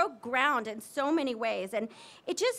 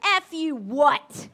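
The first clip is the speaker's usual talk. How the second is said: very slow, loud, drawn-out challenge; daring comeback; 9 dB louder, much slower than usual. Retold or imitated. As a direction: stare him down and dare him to repeat it. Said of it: "she gives him eyes and she goes".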